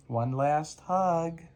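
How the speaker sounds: slow tender bedtime line. Acted. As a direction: linger on the hug. Bedtime book read aloud.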